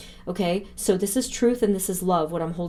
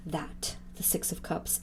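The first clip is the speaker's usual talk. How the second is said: whispered card name, much softer; awed whisper; 3 semitones lower, 13 dB quieter than usual. Real, not acted. Reader whispering the card to the viewers, awed. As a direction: breathe the card name in awe.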